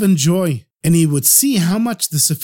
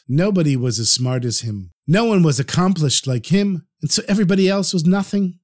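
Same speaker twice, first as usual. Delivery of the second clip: plain reading voice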